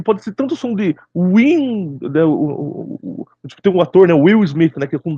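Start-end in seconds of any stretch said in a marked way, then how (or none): none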